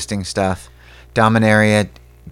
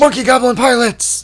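much higher, delighted exclaim; excited squeal; 11 semitones higher, 3 dB louder than usual. Acted. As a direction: squeal it with delight at the silly idea. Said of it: "repeats it marked".

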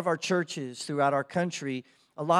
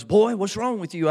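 louder, higher-pitched demanding question; imitating dad; 6 semitones higher, 6 dB louder than usual. Dad questions his crying boy on the way out, imitated demanding tone. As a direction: confront your kid like a puzzled father. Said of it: "and he goes".

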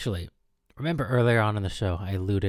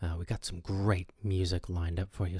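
normal talking voice

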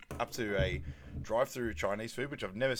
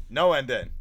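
loud, firm acted refusal; mock-exasperated customer; exasperated retort; 2 semitones higher, 9 dB louder than usual. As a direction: cut the order-taker off loudly. Acted exasperated reply at drive-through.

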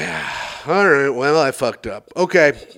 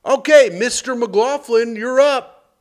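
nurse calling out; 9 semitones higher, 5 dB louder, slower than usual. Retold or imitated. Nurse calls patient in.

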